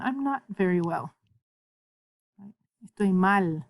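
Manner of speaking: ordinary talk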